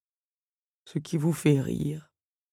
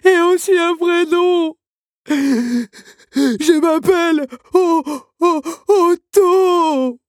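much higher, loud, slow tearful voice; sobbing; 11 dB louder, much slower than usual. A narrator's reading. Crying automaton telling Belle his name, halting. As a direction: stumble on the name through sobs. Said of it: "entre deux sanglots".

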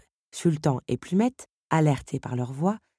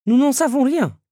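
high, loud candlestick voice; Lumière's voice; 10 semitones higher, 9 dB louder than usual. A narrator's reading. Candlestick answering the Beast, high and loud. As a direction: voice the candlestick, raised and eager.

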